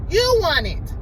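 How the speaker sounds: high, loud, insistent mock accusation; mock accusing. Boyfriend pressing girlfriend in a quarrel, mock accusing. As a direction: imitate someone insisting in a quarrel. Acting out the couple's argument.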